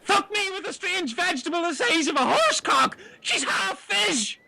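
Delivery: high astonished shriek at a half-fish woman; shocked outburst. Acted. Jimmy shocked by mermaid.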